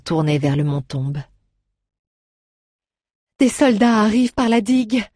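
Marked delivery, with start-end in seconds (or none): 3.4-5.0 high, loud alarm; exclaiming. A narrator's reading. Faye warning the group of approaching soldiers, loud and alarmed. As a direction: cry out the warning, pointing. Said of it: "s'exclama-t-elle".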